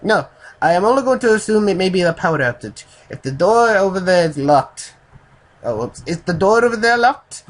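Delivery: high, loud, badly faked Japanese accent; Japanese accent attempt. Narrator voices the Japanese man guessing at a power outage, Japanese accent. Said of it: "I can't do a Japanese accent".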